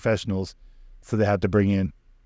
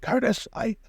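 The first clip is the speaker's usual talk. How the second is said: put-on high, strained dying old man; 10 semitones higher than usual. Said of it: "he's still like".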